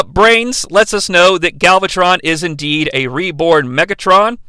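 usual speaking voice

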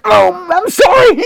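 high, loud startled yelp of an apology; a jolted, squeaky mock apology. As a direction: jump as if caught out and blurt sorry. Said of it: "you're like".

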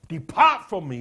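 loud, pleading cry to be left alone; Peter crying out. Acted. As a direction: cry out in shame, pushing him away. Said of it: "he said".

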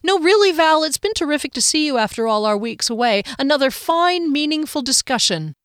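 high, sarcastic gushing; biting false enthusiasm; feigned cheer. A narrator's reading. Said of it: "she said with feigned cheer".

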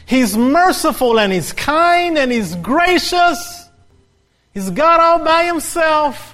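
high, loud rhythmic build of praise; full-force preaching. Real, not acted. Preacher extolling God to the congregation, at full force. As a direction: proclaim each attribute, climbing to a held cry.

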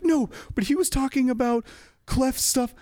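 imitated upset woman, very high; upset insistence. Offended audience member answers staff, upset and insisting.

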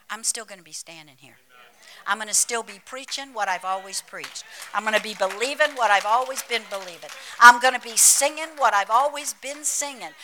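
building to a full preaching shout; defiant declaration. Acted. Preacher telling Satan she will keep on, defiant and building loud.

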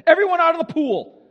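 mock killjoy shout; mock spoilsport shout. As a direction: order everyone out like a killjoy. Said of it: "it's like Jesus shows up".

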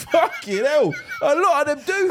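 loud, high, laughing outburst; retold laughing amazement. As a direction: burst out in amused realisation. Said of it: "I was like".

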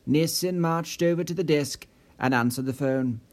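ordinary delivery

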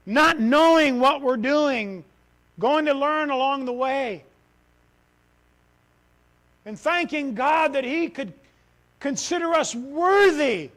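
loud, high, drawn-out fervent preaching; preaching at full force. Acted. Pastor tells church of God's gift, fervent preaching. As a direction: lift it up in praise, slow and strong.

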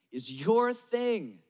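is YOUR thing, slow with a falling end; stress on your. Acted. Preacher to the congregation, slow stress on YOUR. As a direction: lean on YOUR and let it drop. Repeated refrain.

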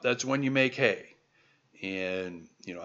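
normal talking voice

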